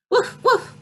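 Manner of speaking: high yappy woof woof; dog bark. Retold or imitated. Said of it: "That's what a dog sounds like, according to Miss Dana".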